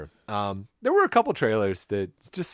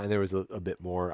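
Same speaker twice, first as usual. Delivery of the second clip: usual speaking voice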